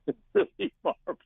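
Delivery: high, laughing voice; words breaking up with real laughter. Real, not acted. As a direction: try to speak through helpless giggles. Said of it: "I'm sorry I'm laughing so much".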